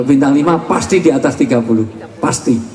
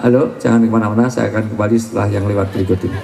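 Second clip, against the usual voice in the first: mock ad break; 5 semitones lower, faster than usual. Mock radio break announcement.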